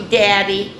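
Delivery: ordinary delivery, nothing marked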